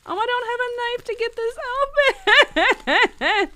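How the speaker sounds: high, breathless delight over a tiny prop; gushing as she unwraps the pickaxe; giddy excitement. Real, not acted. Opening her movie prop pickaxe, high giddy gushing. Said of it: "I'm so excited".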